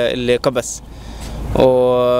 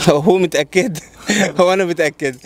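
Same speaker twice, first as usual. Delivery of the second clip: chuckling through the words; laughing; 3 semitones higher than usual. Real, not acted. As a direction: laugh while saying it, unsure. Caller laughs, unsure of answer.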